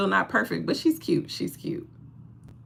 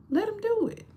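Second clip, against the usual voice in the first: much higher, soft squeaky urging; high squeak; 4 dB quieter than usual. Acted. Urging viewers to let men help, high squeak. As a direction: pipe it up high.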